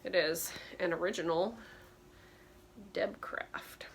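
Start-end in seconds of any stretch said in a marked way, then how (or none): 2.9-3.8 whispered brand name. Real, not acted. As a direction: breathe the name like a secret. Reading the brand tag aloud.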